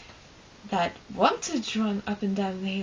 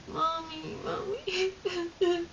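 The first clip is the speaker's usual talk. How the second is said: imitating a crying kid, much higher; crying for mommy; 9 semitones higher, much slower than usual. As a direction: wail it like a small hurt child calling his mom. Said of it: "And he starts crying, like".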